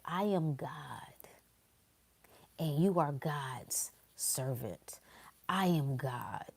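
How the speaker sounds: breathy whisper, slow on the first line; whispered quote. Pretend. Whispering the kid's words to the listeners. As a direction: whisper it like a secret affirmation.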